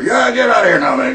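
loud, exasperated get-out; shouting him off. Real, not acted. Shooing a man away.

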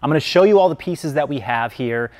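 ordinary delivery

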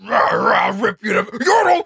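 loud, high growled shout; mock beast roar. Quoting Warwick's sudden shout after being serious, mock roar. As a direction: bark it like a hammed-up monster. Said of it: "and then he's like".

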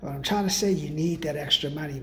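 ordinary talk

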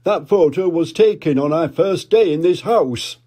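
jolly put-on dad voice; Daddy Pig. Daddy Pig remembering, hearty dad voice. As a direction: chuckle it out as a big daddy pig. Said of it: "said Daddy Pig".

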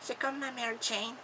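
put-on high, soft English-accented voice. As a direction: answer faintly with an English accent. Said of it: "t'as l'accent anglais". (petite voix aiguë à l'accent anglais)

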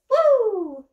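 high, loud whoop of effort; real whoop after lifting; relieved whoop. Real, not acted. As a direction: blow off the strain with a cheer. Celebrating getting whole body in shot.

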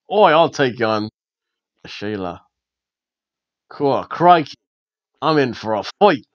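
hammed-up Australian accent; broad put-on Aussie, word by word; mock Australian. Voicing a wrestler accepting a challenge, mock Australian accent.

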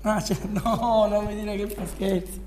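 normal talking voice

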